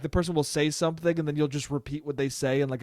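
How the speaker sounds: ordinary delivery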